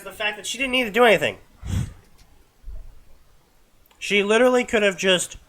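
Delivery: loud, high, fast exasperated rant; rising exasperation, loud and quick. Real, not acted. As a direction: vent your frustration at the plot. Exasperated rant about plot.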